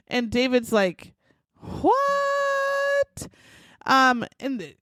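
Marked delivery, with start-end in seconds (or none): 1.6-3.3 long, much higher shouted what; a stretched disbelieving yelp; shocked what. Retold or imitated. David hears the wife is shot.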